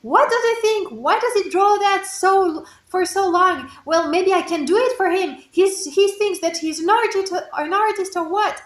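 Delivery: high, loud, indignant complaining; exasperated rant. Retold or imitated. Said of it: "And I got more crazy".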